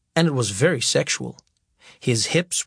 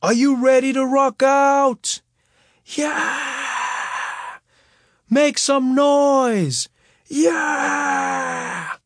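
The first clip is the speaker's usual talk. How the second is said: hype MC shout; 10 semitones higher, much slower than usual. Retold or imitated. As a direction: pump up the crowd like an MC. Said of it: "I got on the mic".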